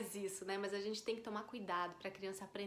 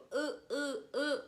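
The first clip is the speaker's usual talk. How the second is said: short higher-pitched imitation of a baby's wordless grunts; grunhido de bebê imitado; 6 semitones higher, 4 dB louder, much slower than usual. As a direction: mimic a toddler pointing and grunting. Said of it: "Que eles ficam". (resmungo de bebê imitado)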